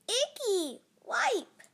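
put-on high, loud little-girl squeal; gleeful child. A narrator's reading. Little girl wiping monster's noses, gleeful cry. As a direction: pipe up in bossy delight.

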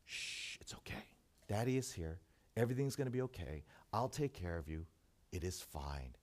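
put-on hushed, soft father soothing a scared child; comforting father. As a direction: whisper it gently like a daddy calming his kid. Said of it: "when a father says to a child".

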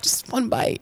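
faint voiceless last request; mock dying plea. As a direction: whisper it like playful last words. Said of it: "as I'm dying".

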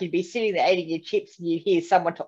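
normal talking voice

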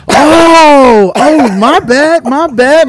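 high, loud, drawn-out innocent sorry; fake apology. To the girls in the wave pool, a hammed-up high fake apology. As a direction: play it like an accidental bump, hamming it up.

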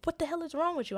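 self-scolding. Retold or imitated. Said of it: "I was mad at myself 'cause I was like".

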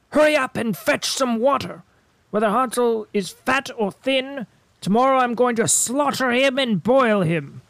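high, harsh witch voice; impatient witch. A narrator's reading. Witch ordering Gretel, high and menacing. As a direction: threaten with impatient glee. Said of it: "she shouted to the girl".

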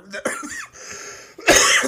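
harsh hacking cough; coughing fit. Real, not acted. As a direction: cough hard a few times. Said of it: "Sorry about my cough".

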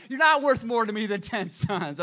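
loud, high acted outburst; mock-furious shout at an idiot husband; exasperated wife. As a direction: yell it like a fed-up wife, hammed up. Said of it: "Hannah and all women say".

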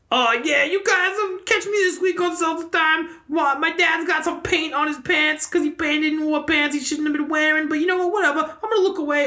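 high put-on voice with an odd accent; [name]. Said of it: "that voice you make".

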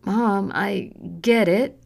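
trembling lip. Acted. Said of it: "her lip trembling".

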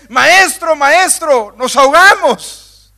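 high, loud cry that sinks into resignation; resigned warning. Acted. Preacher showing disciples warning Jesus, loud and resigned. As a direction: warn him as if already lost. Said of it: "Es más un aviso de resignación".

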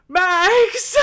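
a loud, high, exhausted gasp of a name; awkward breathy yell. Retold or imitated. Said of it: "in the most awkward way".

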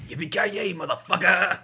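Yippee-ki-yay motherfucker belted high and loud; hammed-up catchphrase. Reading out the number ten movie line, belted. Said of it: "Number ten".

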